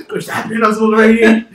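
laughing through the quote; can't stop laughing while quoting. Retold or imitated. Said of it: "I was like".

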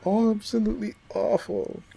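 ordinary delivery

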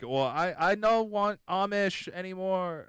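slow, lightly put-on Dutch German accent; a hint of a Dutch German accent, half-joking; mock Dutch German accent. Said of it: "it was a weird Dutch German accent".

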